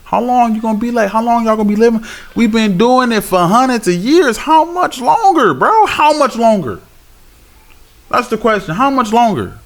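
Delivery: fervent street preacher cry; impassioned preaching. Acted. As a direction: challenge the crowd, stretched and high. Fervent challenge to listeners.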